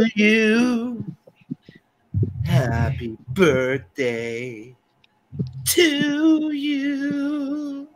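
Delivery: very slow breathy birthday song; Marilyn Monroe. Shown as a demonstration. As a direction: sing it slow and sultry like Marilyn. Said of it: "could do my Marilyn Monroe for you".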